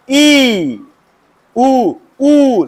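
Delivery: ई and ऊ drawn out long; long vowels. Shown as a demonstration. Teacher demonstrating long vowels to students, held long. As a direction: stretch ई and ऊ clearly. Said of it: "लंबा बोलेंगे".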